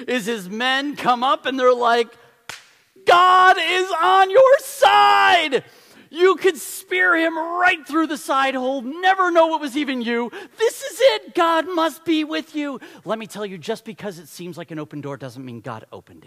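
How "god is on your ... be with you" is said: high, loud, eager men egging him on; hammed-up shout of soldiers urging a kill; men in the cave shouting at David to strike, loud and high; excited urging shout.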